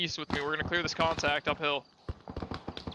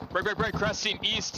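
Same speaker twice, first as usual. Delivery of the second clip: urgent callout; 5 semitones higher than usual. Real, not acted. Urgent contact callout on radio.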